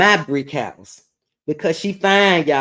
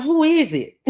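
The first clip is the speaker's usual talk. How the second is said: laughing question; 11 semitones higher than usual. Retold or imitated. Asking God, laughing.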